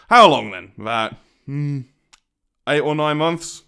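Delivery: sudden loud pressure; menacing demand. Acted. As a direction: bark the question at him. Presses captive.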